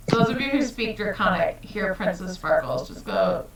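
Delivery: ordinary talk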